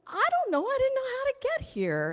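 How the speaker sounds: startled, sheepish high-pitched excuse; flustered surprise. Retold or imitated. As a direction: blurt it out, caught off guard. Her flustered reply to the beings.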